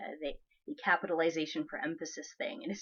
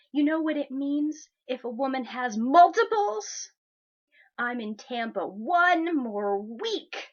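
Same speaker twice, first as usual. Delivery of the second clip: louder, higher mock-bro rant, punching the caps words; mocking entitled guy; 5 semitones higher, 5 dB louder than usual. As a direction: ham up a pushy creep's message with heavy emphasis. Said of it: "So he goes".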